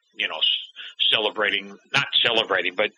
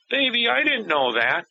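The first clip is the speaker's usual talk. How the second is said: crying; 10 semitones higher than usual. Retold or imitated. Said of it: "And the mom starts crying".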